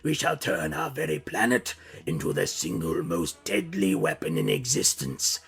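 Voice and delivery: menacing robot warlord voice; slow, grand threat from the tyrant; villain voice. Acted. Narrator voices Megatron's speech.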